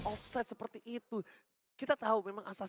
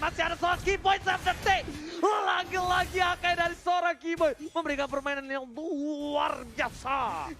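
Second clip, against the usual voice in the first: excited shout; 8 semitones higher, slower than usual. Real, not acted. Caster hypes big play.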